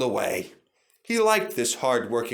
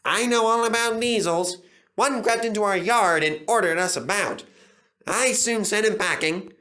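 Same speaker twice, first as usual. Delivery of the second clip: loud, higher bragging; boastful; 8 semitones higher, 3 dB louder than usual. A narrator's reading. James bragging to Duncan, boastful. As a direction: puff yourself up.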